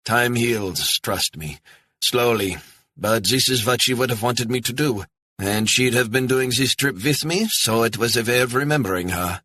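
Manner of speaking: gentle older man, lightly played; a touch of a widower reassuring the stranger; lightly voiced German widower. Gerhard on remembering his wife.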